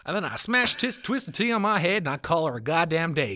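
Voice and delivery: high, loud put-on country twang; country accent. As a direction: declare it as a mock country boy. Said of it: "like, a country accent for it".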